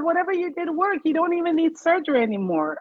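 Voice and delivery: excited doctor. Retold or imitated. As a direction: share the good news in disbelief. Doctor repeats in amazement.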